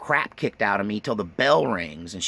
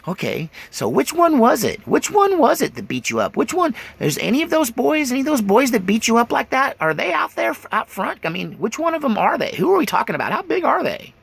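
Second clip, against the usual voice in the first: much higher, keen, prying aunt voice; eager aunt; 12 semitones higher than usual. Retold or imitated. Aunt in the car asking her nephew who beat him, high and eager. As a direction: press the kid for names, pitched way up.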